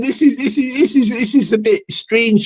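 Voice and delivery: usual speaking voice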